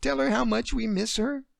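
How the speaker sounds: put-on high child's voice, close to crying; a little girl pleading, nearly crying; near tears. Daughter begging her daddy, near tears. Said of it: "Emily was near tears now".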